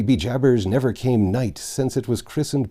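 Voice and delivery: plain reading voice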